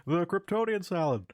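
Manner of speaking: high-pitched observational stand-up voice; Jerry Seinfeld. Acted. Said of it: "that's a Jerry Seinfeld joke".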